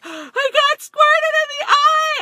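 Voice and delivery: put-on high, loud, slow panicked child's scream. Son at the pool, panicked scream after being squirted. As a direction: shriek it like a scared six-year-old. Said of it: "he's screaming his head off".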